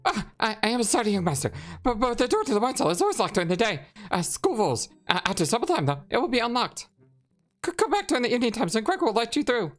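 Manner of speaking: high-pitched, stammering servant voice; apologetic servant. Acted. Servant telling young master the cellar is locked, high apologetic voice. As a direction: apologize high and hurried, tripping over words.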